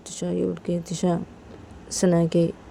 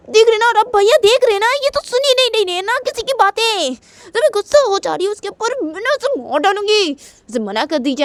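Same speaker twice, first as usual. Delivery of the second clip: much higher, heated complaint that fades at the end; angry complaint; 12 semitones higher than usual. Retold or imitated. Tisha ranting to the family about Shanaya, angry. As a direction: rant to the family about her. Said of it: "तो तिशा बोलती है".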